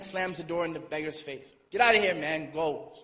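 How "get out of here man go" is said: louder, barking the beggar away; angry shout. Retold or imitated. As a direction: throw him out with a shout. Husband slams door on beggar.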